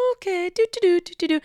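high, sing-song little do-do-do; feigned nonchalance. Retold or imitated. Woman pretending to ignore a drunk man, feigned nonchalance. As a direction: play innocent, pitched well up. Acting out casually looking away.

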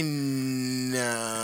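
slow and low, stretching "no"; drawn-out verdict. Real, not acted. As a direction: hold "no" long before letting it land. Drawn-out vote, then said plainly.

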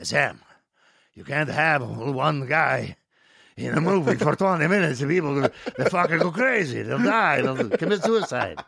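imitated nervous producer, rising in pitch; mock panicked pleading with the director; Dino De Laurentiis, extremely nervous. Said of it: "Dino got extremely nervous".

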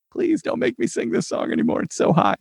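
high, squeaky mock plea; mock pleading. Worn-out singer begging the crew over a song, mock pleading. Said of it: "And I was like".

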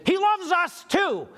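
mock holler, pitched high and stretched out; imitated yell. Woman repeats her complaint to the shirt wearer, imitated yell. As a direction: call it out slowly in a high voice. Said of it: "she yelled at me".

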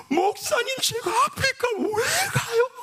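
mock shriek, pitched very high; Donghwan's wailing protest. Young man protests being sent to Africa, hammed-up wail. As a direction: imitate a whining, high-pitched cry of protest. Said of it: "제가 똑같이 흉내낼게요".

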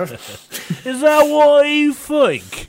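very high, loud, drawn-out British caricature; put-on British accent. Co-host answering the host's challenge, hammed-up British accent. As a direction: stretch it out in squawked British accent. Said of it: "you can do this one".

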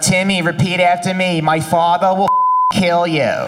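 put-on speech therapist with a thick Staten Island accent; slow, teacherly Staten Island drawl. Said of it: "She's like".

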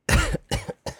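sudden sharp cough; coughing fit. Real, not acted. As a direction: cough out a swallowed bug. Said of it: "I just inhaled a bug".

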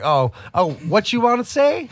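high, slow mock clerk voice; a hammed-up mock drawl, high and slow; mocking dialect. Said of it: "They're like".

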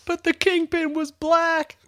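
high-pitched called-out retort; high shout. Retold or imitated. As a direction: fling it after someone leaving. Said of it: "Dave shouts".

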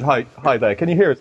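ordinary talk

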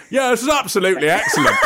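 high, quick, oblivious over-loud voice; hammed-up, high-pitched trade fair enthusiasm; mock loud talking. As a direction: imitate someone in headphones talking too loud. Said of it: "you talk really, really loud".

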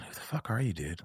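quiet, trailing question to the mirror; muted self-questioning, dropping away at the end; hushed self-confrontation. Retold or imitated. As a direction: softly demand it of your reflection.

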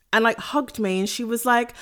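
ordinary talk

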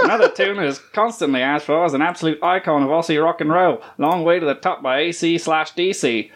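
hammed-up Australian reading voice; Australian accent. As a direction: read it in a broad Australian accent. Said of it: "read this in your best Australian accent".

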